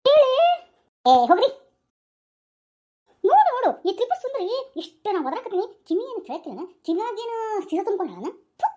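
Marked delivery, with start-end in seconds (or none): 0.1-4.5 loud brush-off. Acted. Daughter-in-law brushing off husband.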